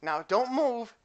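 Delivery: put-on teasing girl voice; playful warning. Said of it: "And she goes".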